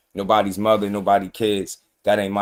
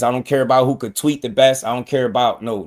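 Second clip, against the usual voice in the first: normal talking voice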